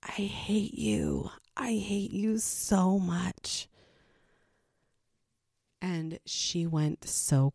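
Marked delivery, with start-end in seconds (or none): 0.0-3.7 hushed, slow, breathy whisper of I hate you; retold scream dropped to a slow whisper. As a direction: whisper it slowly, higher, instead of screaming. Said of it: "I screamed in her face".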